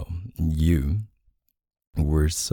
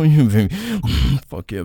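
ordinary talk, nothing marked

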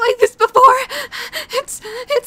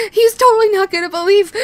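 usual speaking voice

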